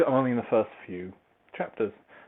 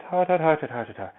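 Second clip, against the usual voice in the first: repeated syllables in a mock Welsh-Scouse accent; high start, falling, Welsh-Scouse caricature; Welsh slipping toward Scouse; 6 semitones higher than usual. Shown as a demonstration. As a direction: overdo the Welsh until it turns Scouse. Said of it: "But you kinda go a bit".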